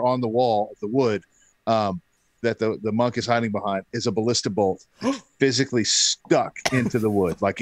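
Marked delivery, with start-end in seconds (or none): none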